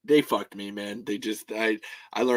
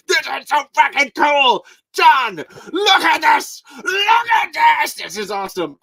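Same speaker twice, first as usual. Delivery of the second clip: excited shout; 13 semitones higher, 8 dB louder than usual. Retold or imitated. Retelling showing drawing excitedly.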